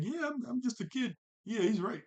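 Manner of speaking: put-on high, playful kid voice; goofy kid impression. Playing Aang goofing around, agreeing.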